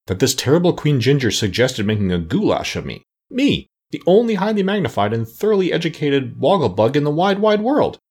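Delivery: pompous outrage, climbing high by the end; affronted, grandly rising boast; distressed objection. A narrator's reading. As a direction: protest as the self-important Wogglebug. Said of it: "who had a distressed look in his bulging round eyes".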